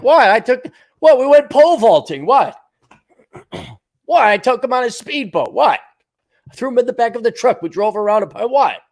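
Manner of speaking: high, loud mock innocence; innocent uncle. Uncle feigning innocence to parents, loud and high. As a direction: play dumb, high and loud. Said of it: "uncle's kinda like".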